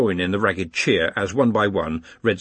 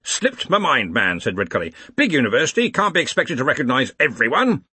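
brusque, higher and a little louder; brisk Arch-Chancellor; 4 semitones higher, 4 dB louder than usual. Acted. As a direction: brush it off with bluff authority. Ridcully brushes it off.

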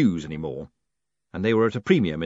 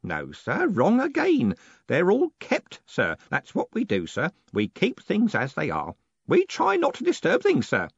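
put-on high old man voice; fussy old clerk insisting; old postman correcting Moist; old Groat; 9 semitones higher than usual. A narrator's reading.

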